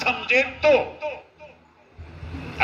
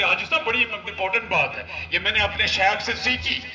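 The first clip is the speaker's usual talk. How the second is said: normal talking voice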